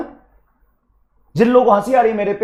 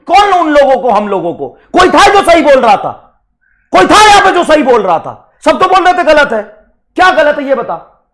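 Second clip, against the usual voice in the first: louder, higher furious demand; angry scolding; 4 semitones higher, 7 dB louder than usual. Real, not acted. Teacher shouting at students who got it wrong, angry. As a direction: tear into the class for an answer.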